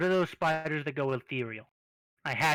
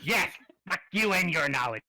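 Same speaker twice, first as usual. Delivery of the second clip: ordinary talk, nothing marked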